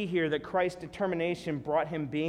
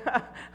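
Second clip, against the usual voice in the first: mock laugh; 9 semitones higher than usual. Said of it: "He didn't go".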